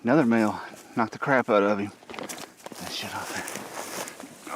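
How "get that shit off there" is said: whispered aside. Real, not acted. Muttered aside while handling coyote.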